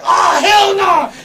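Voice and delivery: slow, very high, loud wail; retold horrified shout. Friends seeing pepper spray coming, horrified shout. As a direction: stretch it into a big yell.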